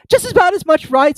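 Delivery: put-on high, loud fussy old duchess. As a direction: prattle like a silly duchess. Duchess character voice.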